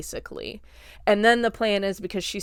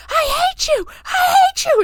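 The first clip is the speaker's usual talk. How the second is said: very high, loud screamed imitation; enraged boy; 8 dB louder than usual. Robbie attacking the clown, imitated high-pitched enraged scream. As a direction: shriek it like a kid in a fight.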